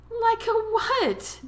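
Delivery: high, slow, amused incredulous question; chuckling. A narrator's reading. Daphne questions Tom's teasing, chuckling back. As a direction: draw out the question, tickled. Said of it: "Daphne chuckled back at him".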